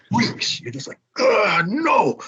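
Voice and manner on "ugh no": high, pained groan of disgust; disgusted ugh. Retold or imitated. American guest recoiling from the fish omelet, disgusted. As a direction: recoil from the smell.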